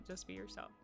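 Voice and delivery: ordinary talk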